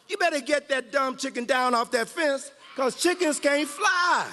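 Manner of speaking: a jeering shout at the eagle; chicken shouting. Acted. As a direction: taunt the eagle up on the fence. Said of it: "one of the chickens shouted".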